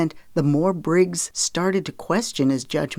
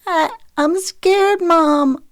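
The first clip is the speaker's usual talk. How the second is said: put-on very high, slow child's plea; ragged gasps; 13 semitones higher, 5 dB louder, much slower than usual. A narrator's reading. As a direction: whimper haltingly to Mom like a scared boy. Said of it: "said in between ragged gasps".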